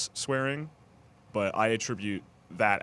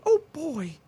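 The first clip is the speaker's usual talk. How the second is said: put-on much higher, chirpy "Oh, boy"; innocent "Oh, boy"; 5 dB louder than usual. Teasing the friend who won't swear, a high chirpy oath. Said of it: "you say".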